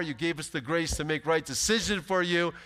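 ordinary talk

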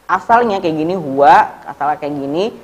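ordinary talk, nothing marked

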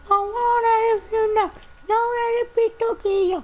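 squeaky falsetto, sung out. Pretend. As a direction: go up into a silly falsetto. Sudden high put-on voice.

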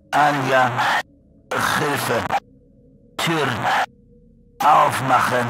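distant crackling mimic of Anja; intercom voice. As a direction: call through the intercom, eerie. Monster mimics Anja over intercom.

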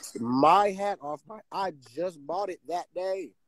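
high-pitched, animated disbelief; worked-up retelling. Real, not acted. Telling friends about his snatched hat, worked-up and high. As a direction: tell it all worked up, voice riding high.

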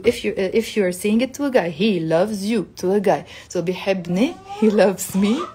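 usual speaking voice

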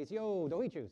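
cheerful short greeting; "Sio dohichu" lifted and friendly; Cherokee greeting. Shown as a demonstration. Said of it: "or I'd say".

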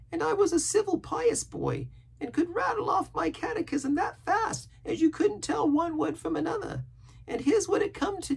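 very high, earnest sailor voice; pious rambling castaway. A narrator's reading. Castaway telling Jim of his pious youth, earnest.

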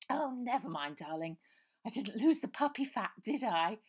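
high, loud plummy lady; posh voice. A narrator's reading. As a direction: sigh it like a posh girl. Posh girl jokes.

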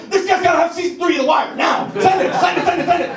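urgently hustle the DVD out the door; frantic cokehead. Retold or imitated. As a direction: manic hammed-up rush. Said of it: "They're all doing lines in a basement like".

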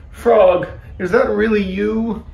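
high, hopeful question; amazed relief. A narrator's reading. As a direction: ask as if just woken from a bad dream. Toad wakes and finds Frog.

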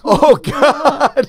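laughing through oh God; high laughing dismay; laughing groan. Real, not acted. Listener reacts to story.

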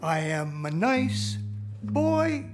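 ordinary delivery

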